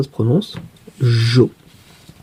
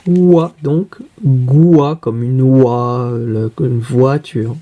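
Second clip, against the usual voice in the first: sounding out slowly; much slower than usual. Shown as a demonstration. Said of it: "ça fait le son".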